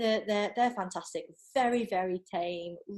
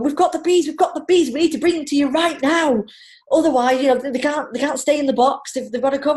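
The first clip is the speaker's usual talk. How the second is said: urgent bee keepers; 6 semitones higher, 10 dB louder than usual. Retold or imitated. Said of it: "the bee people ring me, going".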